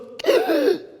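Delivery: high sharp gasp for air; a child's desperate breath; imitated gasp. Toddler getting a breath past the cap, high gasp. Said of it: "she goes".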